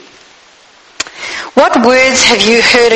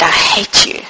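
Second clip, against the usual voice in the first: hushed hurtful words; 5 semitones lower than usual. Acted. Quoting hurtful words spoken over someone.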